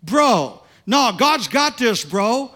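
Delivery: put-on loud, high, half-drunk insistence; loud slurred encouragement from a drunk guy; loud drunk preaching. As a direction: shout it like a tipsy stranger who means it. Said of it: "he starts talking real loud".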